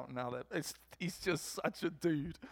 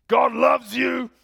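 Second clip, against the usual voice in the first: put-on booming, slow, high-raised old preacher shout; Vernon; 9 semitones higher, 14 dB louder, slower than usual. Old biker declares God's love to the congregation, thunderous shout. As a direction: roar it like rolling thunder. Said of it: "it's like rolling thunder".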